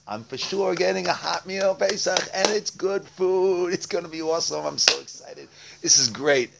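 high-pitched, eager anticipation; acting out a man thrilled about what's coming; excited. Beggar telling his friend about the coming Pesach meal, excited. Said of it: "he gets all excited".

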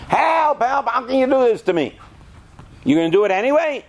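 put-on high, wailing plea of a scared kid; mock child panic. Father retells child's screams at shots, high mock wail. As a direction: play the kid begging at the doctor.

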